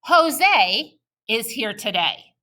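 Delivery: Jose is here today with a small pause after Jose, slow and smooth; scooped phrasing. Shown as a demonstration. Teacher showing kids fluent phrasing, slow scooped reading. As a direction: read in smooth scoops, slowly. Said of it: "Let's try it".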